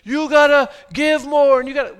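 lampoon a guilt-tripping pulpit; mock pushy preacher. Preacher mocking churches that demand money, pushy bark. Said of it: "I don't wanna come here and say".

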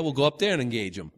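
ordinary talk

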